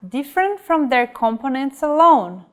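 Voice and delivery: ordinary delivery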